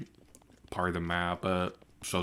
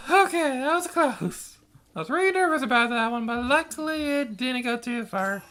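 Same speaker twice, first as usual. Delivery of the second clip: excited relief; 5 dB louder than usual. Real, not acted. Right after the near miss.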